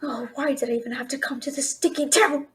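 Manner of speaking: self-pitying mutter; muttered aside. Acted. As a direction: sulk under your breath.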